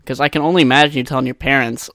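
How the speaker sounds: ordinary delivery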